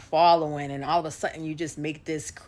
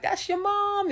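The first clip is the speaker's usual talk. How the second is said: surprised. Retold or imitated. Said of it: "they're like".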